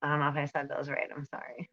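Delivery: normal talking voice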